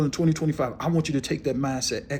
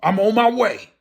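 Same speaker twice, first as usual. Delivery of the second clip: louder, higher proclamation; emphatic declaration; 6 semitones higher, 5 dB louder than usual. Shown as a demonstration. Preacher voicing what his roar tells people, loud declaration. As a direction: belt it out as a warning. Repeats roar's message forcefully.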